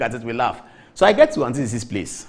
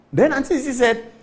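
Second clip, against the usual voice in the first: ordinary delivery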